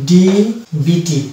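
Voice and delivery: DBT spelled out very slowly, letter by letter; spelling out letters. Shown as a demonstration. Teacher spelling a word for students, very slowly. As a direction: draw out each letter of DBT. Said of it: "যেমন কিছু শব্দ রয়েছে".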